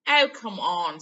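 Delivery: loud impatient; exasperated Ron. Acted. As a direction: cut her off. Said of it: "said Ron".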